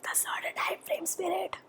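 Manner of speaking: breathy whispered aside to spirit. Real, not acted. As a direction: drop to a private whisper.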